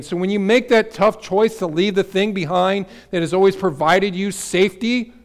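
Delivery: loud, ringing pulpit preaching; full-voiced sermon climax; preaching at full force. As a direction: preach it out to the whole room. Preaching builds in the Easter message.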